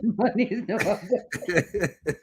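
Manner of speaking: laughing through the words. Real, not acted. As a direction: chuckle as you say it. Repeats line laughing.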